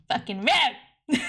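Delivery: laughing mock outrage. Real, not acted.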